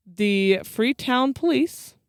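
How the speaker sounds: ordinary talk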